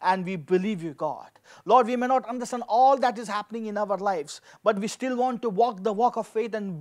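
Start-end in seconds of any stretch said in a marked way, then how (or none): none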